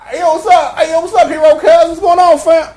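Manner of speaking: lightly voiced hype friend. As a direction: greet him like an eager homie.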